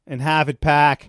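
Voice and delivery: hammed-up Boston accent, punched loud. Listing New England things to co-host, mock Boston accent. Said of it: "I hate that accent".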